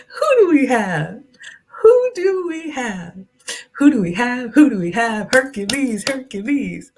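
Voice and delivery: high, excited playful chant. Real, not acted. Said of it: "I get excited, y'all".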